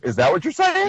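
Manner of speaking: high-pitched mock incredulous question; self-catching disbelief. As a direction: catch yourself out, pitch lifting. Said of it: "If you catch yourself, it's like".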